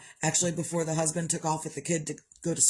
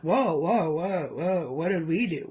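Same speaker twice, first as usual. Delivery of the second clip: lightly voiced startled "whoa"; a hint of defensive surprise; mock startled family; 3 dB louder than usual. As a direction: play the family caught off guard. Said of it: "And they're like".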